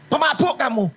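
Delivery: high sharp rebuke, pemabuk punched; a priest snapping at a praying woman, sharply; harsh scolding. Acted. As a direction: scold her as a drunk. Preacher acts Eli scolding Hana.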